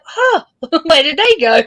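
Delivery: high-pitched comic dismay; mock bewilderment. Streamer finding new subscribers gone, mock bewildered. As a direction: act out a startled discovery.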